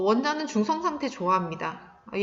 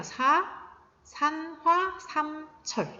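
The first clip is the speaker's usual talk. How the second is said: high, segmented chemical-name readout; reading demonstration; 6 semitones higher, much slower than usual. Shown as a demonstration. Teacher showing students how to read the formula, high and segmented. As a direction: read it out piece by piece, pitched up. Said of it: "이런 식으로 읽어주면 돼요".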